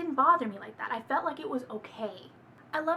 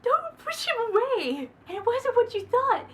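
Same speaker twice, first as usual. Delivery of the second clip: pleading; 10 semitones higher, 5 dB louder than usual. Real, not acted. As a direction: beg her to stop pushing him away. Pleading with the heroine.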